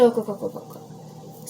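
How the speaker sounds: look, look trailing to a whisper; quiet insistent look; fading to a whisper. Real, not acted. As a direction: urge them to look, fading out. Points at her fingers.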